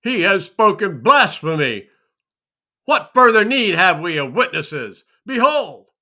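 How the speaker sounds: loud, high, scandalised outburst; outraged cry. Acted. High priest denounces Yahushua's blasphemy, furious loud outcry. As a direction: cry out as if tearing your robes in horror. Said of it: "Then the high priest rent his clothes".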